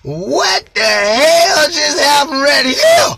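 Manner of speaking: high, loud, slow shocked yell; stunned outburst at the paper; shocked shout. Retold or imitated. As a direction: shout it out in disbelief, dragging it. Retelling shock at the sheet.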